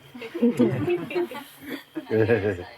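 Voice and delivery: a bright, high burst of laughter. Real, not acted. Teacher delighted by Konju's answer, high laugh.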